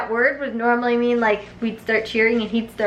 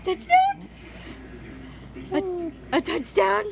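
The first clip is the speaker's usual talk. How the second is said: much higher and softer, singsong to the dog; high coaxing dog talk; 9 semitones higher, 8 dB quieter than usual. Real, not acted. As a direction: coo questions at the dog playfully.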